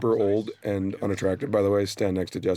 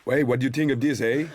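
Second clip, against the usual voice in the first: put-on a touch of Quebec accent, teasing, a bit higher and brighter with a tagged-on eh; mock Quebec accent; 5 semitones higher, 3 dB louder than usual. Co-star teasing the Quebecer with her accent, mild mock Quebec accent. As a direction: play up the Quebec lilt on the eh. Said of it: "I'm Quebecer".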